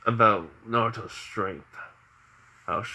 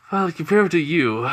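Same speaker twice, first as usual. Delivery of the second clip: put-on proud old man; 7 semitones higher, 5 dB louder than usual. Hiruzen praises Naruto.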